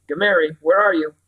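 loud anxious call across the headland; calling loudly. Acted. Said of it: "She called more loudly".

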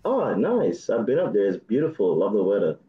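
ordinary delivery

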